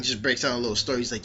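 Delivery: usual speaking voice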